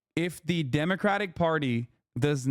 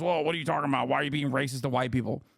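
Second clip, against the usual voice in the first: lower, mocking whiny voice imitating a critic; sneering at someone who cries reverse racism, in a lower whiny voice; mock whiny objector; 3 semitones lower, faster than usual. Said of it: "fucking corrects you on that and goes".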